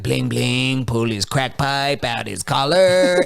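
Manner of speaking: slow crackhead voice imitation. Crackhead character about to smoke on camera, slow put-on voice. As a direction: drag out the catchphrase as a crackhead. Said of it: "he used to sing this song".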